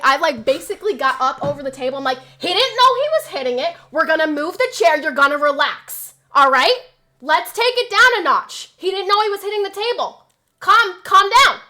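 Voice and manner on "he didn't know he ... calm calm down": loud, high defensive yell; yelling back. Retold or imitated. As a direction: shout down the angry guest, defending dad. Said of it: "I'm, like, yelling back at this guy".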